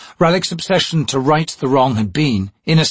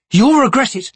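a higher, louder threat, harsh; harsh warning; 13 semitones higher, 5 dB louder than usual. A narrator's reading. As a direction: warn her she'll pay for it. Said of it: "in a harsh voice".

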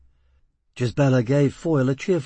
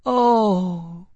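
high, louder cry falling in pitch; heart-rending descending note; 7 semitones higher, 4 dB louder than usual. A narrator's reading. Dream angel's cry to Foyle, descending heartbreak. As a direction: let the cry fall in despair. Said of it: "in a heart-rending descending note".

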